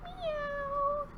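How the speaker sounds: high, soft meow; cat meow. Pretend. Answering children what cats say, soft high meow. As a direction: mew like a little kitten. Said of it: "what do cats say".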